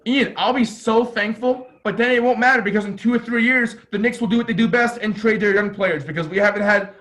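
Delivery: fed-up sports fan letting it all out; exasperated fan rant. Real, not acted. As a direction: complain loudly about your hopeless team. Fan venting about the Knicks.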